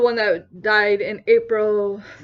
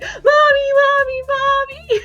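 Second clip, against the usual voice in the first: very high, loud wail for mommy; panicked child; 14 semitones higher, 4 dB louder than usual. Retold or imitated. Child calling after mom's departing car, imitated panicked wail. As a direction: imitate a frantic kid crying out. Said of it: "freaking pure panic fucking rushed into me".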